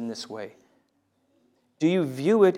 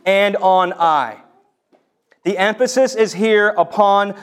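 raised, emphatic preacher's voice; forceful preaching; 5 semitones higher, 7 dB louder than usual. Real, not acted. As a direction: drive the point home to the husbands.

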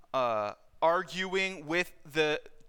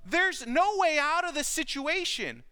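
desperate cry; 6 semitones higher than usual. Acted. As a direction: cry out as if cornered with no way out. Voicing trapped Paul's despair.

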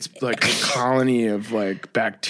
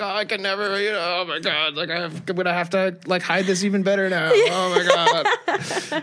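higher, flustered mock panic; panicked Jax impression; 7 semitones higher than usual. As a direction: play the panicking groom hiding his secret, pitched up.

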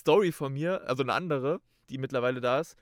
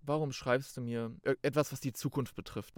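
softer, thinking to himself; softer private thought about the rival's message; quiet inner thought; 3 semitones lower, 8 dB quieter than usual. Retold or imitated. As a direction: say it under your breath, to yourself. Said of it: "Und ich denk mir so".

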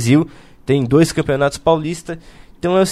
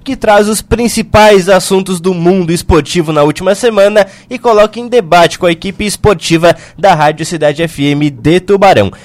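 radio announcer; 4 semitones higher, 5 dB louder than usual. Acted. Radio show opening.

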